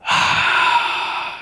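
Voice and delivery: long breathy sigh of exasperation; heavy sigh. Retold or imitated. Preacher shows God sighing over his people, a long sigh. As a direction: sigh like a tired parent. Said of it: "he goes".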